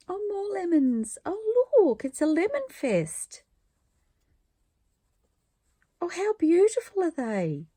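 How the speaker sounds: excited squeals of delight. Real, not acted. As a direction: gush over each new print. Delighted unpacking lemon prints.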